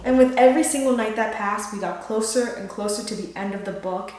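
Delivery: usual speaking voice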